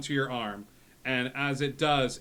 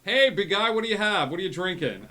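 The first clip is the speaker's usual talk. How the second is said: loud, higher, jovial call to a customer; hailing bartender; 7 semitones higher, 7 dB louder, faster than usual. Acted. Wine in Hand offering the knight a drink, loud hailing. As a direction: hail a big guy across the bar, easing off.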